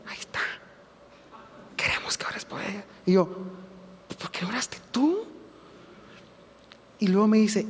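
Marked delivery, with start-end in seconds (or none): 0.1-2.8 whispered bedside request; hushed whisper. Retold or imitated. Doctor asking the minister to pray for her, whispered. Doctor points to the patient, retold. 4.2-5.2 comic whispered comeback, rising at the end; whispered retort. Retold or imitated. Nervous young minister whispering back to the doctor. His reluctant reply at bedside, retold.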